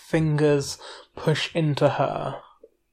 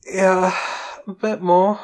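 higher, dazed with lust; hazy, pitched-up, lust-drunk reply; drunk on pleasure; 4 semitones higher than usual. A narrator's reading. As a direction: answer clumsy-tongued, in a blissful haze. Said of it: "She sounds fuck drunk".

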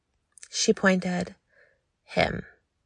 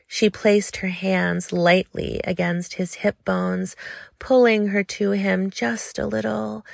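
ordinary delivery, nothing marked

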